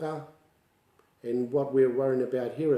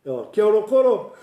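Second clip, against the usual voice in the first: greeting the elder; 9 semitones higher, 9 dB louder than usual. Retold or imitated. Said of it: "I says".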